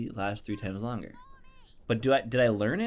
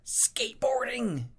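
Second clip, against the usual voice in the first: high whisper, slow; whispered answer; 13 semitones higher, slower than usual. Pretend. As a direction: breathe it out mysteriously. Joking character bit.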